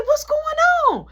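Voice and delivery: very high, loud question; alarmed mom. Retold or imitated. Mom bursts in on the fight, very high and loud. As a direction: burst in demanding answers. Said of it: "She said".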